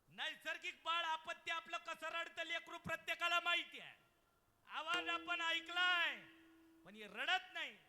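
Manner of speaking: loud, high sermon cry; full-force preaching. Acted. Kirtankar telling the birth story, loud preaching. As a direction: preach it out to the back row.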